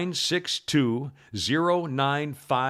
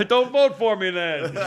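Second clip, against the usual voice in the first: higher, louder, laughing through the retort; helpless laughter on a joking comeback; 5 semitones higher, 6 dB louder than usual. Real, not acted. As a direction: crack up around the words. Joking back amid big laughter.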